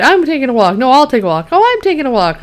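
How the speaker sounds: high, sing-song retold squabble; mock bickering. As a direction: act out both sides of an old argument. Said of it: "they go into that old fight".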